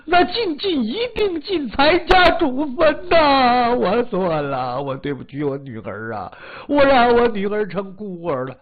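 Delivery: high, loud wail sinking into grief; acted sobbing lament; anguished wailing. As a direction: cry out in remorse, dragging the words. Guilty husband wails over dead wife.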